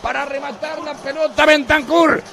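screaming goal call, high and loud; breakneck goal commentary. Real, not acted. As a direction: roar the equaliser over the radio.

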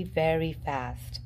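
ordinary delivery

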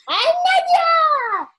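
high excited shout of her name. Real, not acted. Introducing best friend on camera, excited squeal.

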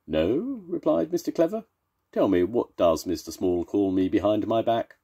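high, clever storybook voice; high character voice. Acted. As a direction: voice him high and prim.

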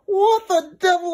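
high, slow, stunned exclamation; what the devil, drawn out in shock; astonished gasp. Acted. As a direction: gasp it out in disbelief. Narrator gasps in astonishment.

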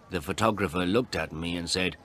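ordinary delivery

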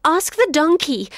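put-on high, eager boy's plea; boy pleading with the lion; urgent boy voice. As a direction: push the idea quickly. Jabu pleads.

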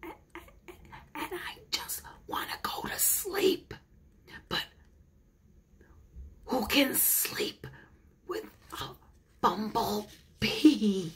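tired little rabbit whispering; sleepy whisper. Acted. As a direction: breathe it out, sleepy and small. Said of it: "All I can do is whisper".

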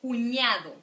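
cuñado with a clear ny sound on ñ; model the ñ. Shown as a demonstration. Teacher to students, modeling the ñ sound. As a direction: stress the ñ in cuñado. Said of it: "because the ñ, it's really important".

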